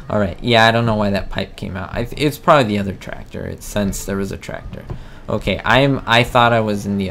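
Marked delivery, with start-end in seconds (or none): none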